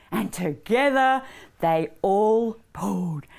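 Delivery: lively, measured singsong narration; rhythmic, clearly phrased story voice; children's storytelling. A narrator's reading. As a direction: read a cumulative tale to little ones.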